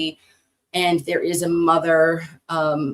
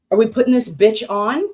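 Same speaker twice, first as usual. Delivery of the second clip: ordinary talk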